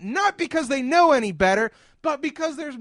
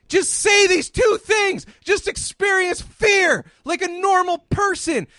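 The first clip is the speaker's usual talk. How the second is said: ranting shout; 6 semitones higher than usual. Real, not acted. Ranting to Michael about his brother, shouting. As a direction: shout it like you've had enough.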